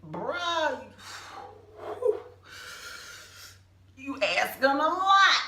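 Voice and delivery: exasperated sass; exasperated to God. Retold or imitated. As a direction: talk back to the Lord like a buddy. Complaining to God in story.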